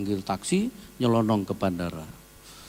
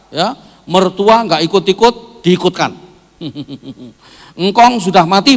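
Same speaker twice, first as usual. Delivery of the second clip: loud, high, animated sermon rant; mock quarrelling families at full voice; forceful comic preaching; 10 semitones higher, 14 dB louder than usual. Real, not acted.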